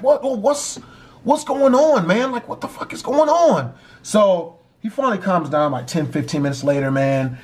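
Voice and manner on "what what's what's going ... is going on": high-pitched retold alarm; raised voice, demanding to know; alarmed questioning. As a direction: act out bewildered alarm at someone's breakdown. Retold alarm at brother's breakdown.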